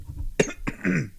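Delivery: sudden dry coughing fit; coughing on dust. Real, not acted. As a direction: cough hard on inhaled dust. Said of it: "It's like I inhaled some dust".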